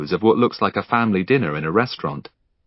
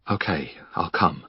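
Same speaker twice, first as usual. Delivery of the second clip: much softer and lower, conceding; soft surrender; 6 semitones lower, 8 dB quieter than usual. Acted. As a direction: give in quietly after the hug. Finn giving in after embrace.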